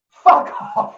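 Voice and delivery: loud, high snapped fuck off; angry shout. Retold or imitated. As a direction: bark it at the drunk guest. DJ shouting at drunk guest.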